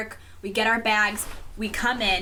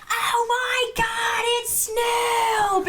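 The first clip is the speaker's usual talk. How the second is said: high excited shriek, slow and drawn out; imitating mom screaming at a celebrity; freaking out; 14 semitones higher, much slower than usual. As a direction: mom starstruck, shouting her joy. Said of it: "freaking out".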